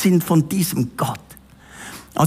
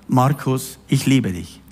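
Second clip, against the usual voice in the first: tender voice of God; 7 semitones lower than usual. Retold or imitated. God's voice speaking to him.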